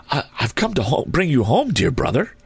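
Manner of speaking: put-on high-pitched eager child; high little-girl voice, full of glad news. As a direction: pitch it up like a small excited sister. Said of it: "said the child".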